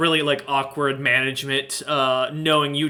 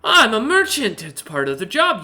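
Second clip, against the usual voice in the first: merchant voice; 7 semitones higher, 6 dB louder than usual. Acted. Merchant answers.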